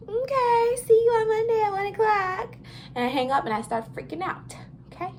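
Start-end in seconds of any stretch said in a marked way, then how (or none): 0.1-2.6 much higher, louder, sing-song phone politeness; bright high "professional" phone voice; chipper phone voice. Retold or imitated. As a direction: put on your sweetest phone voice to say yes. Accepting interview on the phone.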